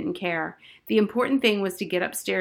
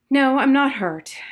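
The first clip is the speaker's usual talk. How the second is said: put-on weary girl answer; 6 semitones higher, 7 dB louder than usual. A narrator's reading. Harriet sighing answer.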